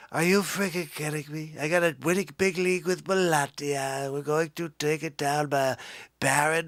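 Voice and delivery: Donald Trump voice, boastful rally cadence; Trump impression. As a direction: brag like Trump at a rally, big and pleased. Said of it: "celebrity rap jam with Trumper".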